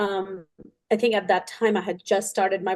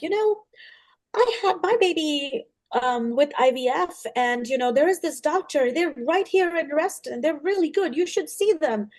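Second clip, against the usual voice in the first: lightly voiced chatty mom, much higher; pitched-up eager mother recommending a doctor; quoting the mom; 6 semitones higher than usual. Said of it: "this mom's like".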